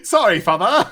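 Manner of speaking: put-on high, laughing English-accented apology; proper English accent. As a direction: play a boy with a proper English accent, amused. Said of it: "like, a, a proper English accent".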